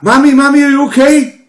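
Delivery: high, loud, anxious mother's voice; worried mother. Retold or imitated. Mother checks on grandma in a high, loud fuss. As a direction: call out like a worried daughter. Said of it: "my old girl's like".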